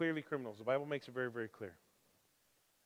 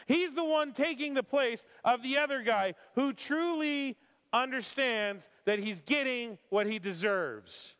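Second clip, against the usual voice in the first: loud, insistent preaching; 9 semitones higher, 6 dB louder than usual. Acted. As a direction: drive the point home to the congregation. Preaching at full force.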